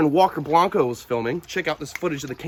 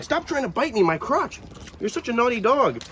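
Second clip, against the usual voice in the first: playful scolding of dog; 7 semitones higher than usual. Real, not acted.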